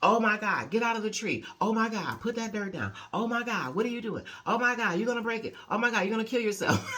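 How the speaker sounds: mock-frantic mother hovering over her boys; panicky hovering mom. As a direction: imitate an overprotective mom panicking at every move. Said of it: "that kind of mom where I hovered".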